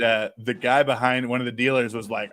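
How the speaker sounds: ordinary talk, nothing marked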